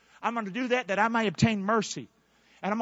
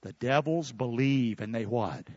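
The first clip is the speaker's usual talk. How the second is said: ordinary delivery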